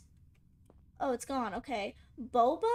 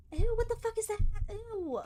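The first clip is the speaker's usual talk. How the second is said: high, loud disgusted squeal; loud grossed-out squeal; 9 semitones higher, 4 dB louder than usual. Real, not acted. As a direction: recoil in disgust. Disgusted at a creepy figure.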